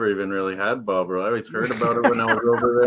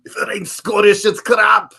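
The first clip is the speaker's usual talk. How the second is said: hammed-up angry Scottish accent, higher and much louder; over-the-top Scottish accent rant; angry Scotsman; 10 semitones higher, 8 dB louder than usual. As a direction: bellow it like a furious Scot in a Scottish accent. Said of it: "going full angry Scotsman".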